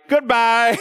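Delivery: high, loud, sing-song brush-off; dismissive goodbye. Retold or imitated. Pastor brushing off a guilt-trip post, high mocking goodbye. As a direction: wave them off with a bright goodbye. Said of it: "And I'm like".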